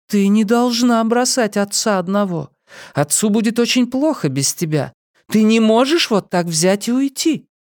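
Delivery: high, gentle motherly admonishing; high-pitched mother's voice. A narrator's reading. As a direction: reason with a daughter in a lifted voice. Mother gently admonishes her daughter.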